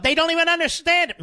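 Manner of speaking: high, exasperated scoff; exasperated outburst. Real, not acted. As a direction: throw up your hands in disbelief. Host exasperated at the media.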